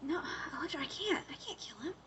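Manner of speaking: soft, broken whispered refusal; pleading whisper. Retold or imitated. Matt refuses Electra's order to kill, in a soft whisper. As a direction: whisper it, unable to go through with it.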